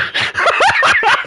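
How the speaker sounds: very high-pitched sudden laugh; real high giggle; burst of laughter. Real, not acted. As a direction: burst out laughing at your own thought. Cracks up at own thought.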